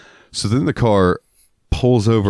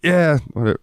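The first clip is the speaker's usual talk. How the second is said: higher, brushing-off reply; dismissive; 9 semitones higher than usual. Retold or imitated. Wes brushing off his coworker, high dismissive tone. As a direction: wave it off. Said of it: "And he's like".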